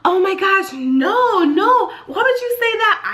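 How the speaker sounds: high, flustered mom imitation; shocked denial. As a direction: act out a mom caught out, denying it frantically. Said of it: "this is how I imagine her face".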